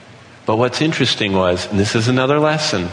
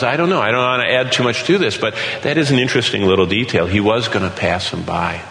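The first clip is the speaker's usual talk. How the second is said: ordinary delivery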